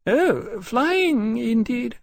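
high, loud, scandalized lady; indignant. A narrator's reading. Mother rejects an offer to go flying, indignantly. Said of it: "she said indignantly".

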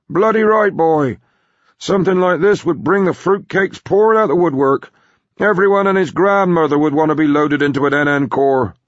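put-on high, loud, blustering old man; brash old grandpa. Grandpa agreeing the secret must be kept, brash and loud.

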